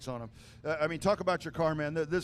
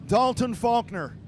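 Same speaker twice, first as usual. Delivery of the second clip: booming PA announcer call; race announcer; 5 semitones higher, 6 dB louder than usual. Acted. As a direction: announce the finish to the grandstand. PA announcer calling race finish.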